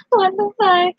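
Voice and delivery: high, disbelieving swear; sudden high what-the-fuck; shocked. Retold or imitated. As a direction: blurt it out in shock. Retelling her own shocked reaction.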